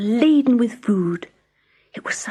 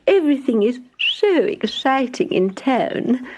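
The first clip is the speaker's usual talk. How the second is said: scornful mouse; 6 semitones higher than usual. Acted. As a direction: look down on the country life. Town Mouse scorns country life.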